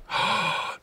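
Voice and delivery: sharp scandalised intake of breath; theatrical shocked gasp; mock gasp. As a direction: gasp in feigned horror. Mimics shocked objectors.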